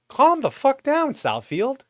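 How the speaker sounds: high, loud, slowed cry of disbelief; exasperated outburst. Real, not acted. Venting at the city over the lien threat: high, loud and drawn out. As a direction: throw your hands up at the city.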